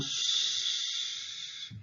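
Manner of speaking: "Ш" held as a long, soft hush; consonant demonstration. Shown as a demonstration. Teacher shows learners an interesting sound, hushing a long "Ш". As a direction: sustain "Ш" as voiceless sh air, no vowel. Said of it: "very interesting sound".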